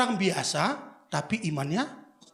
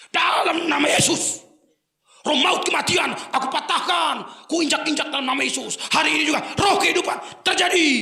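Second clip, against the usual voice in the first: fervent prayer; 7 semitones higher, 6 dB louder than usual. Retold or imitated. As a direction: declare it with full force. Reenacted prayer for the sick. (doa penuh kuasa, lantang dan lambat)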